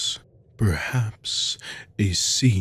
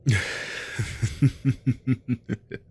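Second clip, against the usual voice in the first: evil chuckle; 5 semitones higher than usual. Acted. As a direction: chuckle over a helpless captive. Villain mocks captive.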